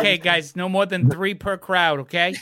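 loud, stern mall cop. Retold or imitated. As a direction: order the teens to disperse. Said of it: "Keith, he's like".

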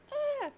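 high mock yelp; imitated startled yelp. Mom startled by the cat, mock high yelp. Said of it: "She's like".

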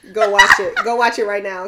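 usual speaking voice

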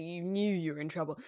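ordinary talk

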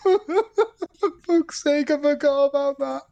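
high-pitched, slow words through real laughter; can barely get the words out for laughing; laughing hard. Real, not acted. Cracking up at his friend's silent Clay joke, laughing through the words.

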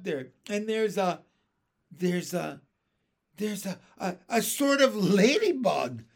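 high, loud, halting astonishment; flabbergasted. Acted. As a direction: stammer out the unbelievable sight. Captain reports impossible creatures.

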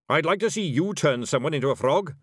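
snappish challenge. Acted. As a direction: dare them scornfully. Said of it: "snapped Zack Zack".

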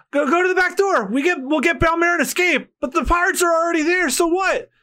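high urgent shout. Acted. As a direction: yell it while running. Girls running to save mother.

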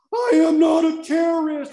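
slow, very high full-voice yell; retold shouted outburst. Surrounded passenger yelling at cops, long high shout. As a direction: scream it out word by word.

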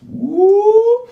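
very high, loud "woo"; exultant whoop. Real, not acted. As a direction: let out a preacher's piercing whoop. Exclaims during scripture reading.

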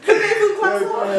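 high, laughing French croissant; mock French accent. Accent guessing game, laughing, in a high mock French accent. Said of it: "French, French".

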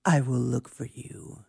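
a hint of a gravelly action hero; menacing movie threat. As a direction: promise to hunt them down, slowly. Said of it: "the line from Taken".

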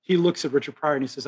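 ordinary delivery

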